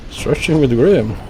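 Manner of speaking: playful segment announcement; announcing a show title. Acted. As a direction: proclaim the segment name like a host.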